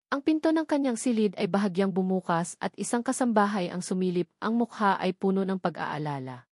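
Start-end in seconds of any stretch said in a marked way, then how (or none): none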